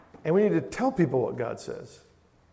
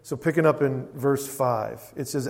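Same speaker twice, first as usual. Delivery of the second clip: normal talking voice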